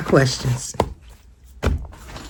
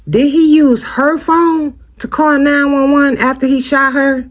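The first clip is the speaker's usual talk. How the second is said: stunned realization; 8 semitones higher than usual. Real, not acted. To viewers, suddenly struck by a new thought, stunned. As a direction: pitch jumps higher as the thought lands.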